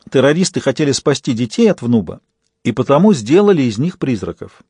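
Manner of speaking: plain reading voice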